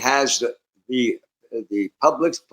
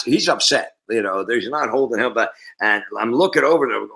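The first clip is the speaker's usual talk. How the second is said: ordinary talk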